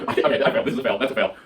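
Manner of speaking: giggly, self-mocking laugh through the words. Real, not acted. To viewers after failing the one-leg stand, laughing. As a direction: laugh at your own failed balance test.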